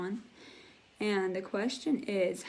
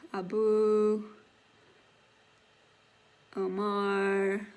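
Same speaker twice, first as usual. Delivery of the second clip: normal talking voice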